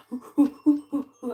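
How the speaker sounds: loud high mock monkey hooting; imitating monkeys shrieking outside at 4 a.m; monkey hoots. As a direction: hoot like a wild monkey at night. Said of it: "They're like".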